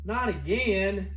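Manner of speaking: slow, drawn-out, weary disbelief; exasperated groan. Real, not acted. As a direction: sigh it out like it's broken yet again. Finds back door broken again.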